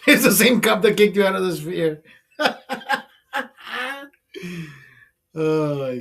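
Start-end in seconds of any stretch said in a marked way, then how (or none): 2.4-3.0 high laugh. Real, not acted. Laugh at cop story.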